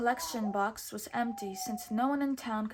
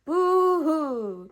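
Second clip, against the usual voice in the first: high loud hammed-up boo-hoo; skit's Prince John dummy, mock crying; 6 semitones higher, 10 dB louder than usual. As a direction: wail like a spoilt child. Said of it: "cried the dummy of Prince John".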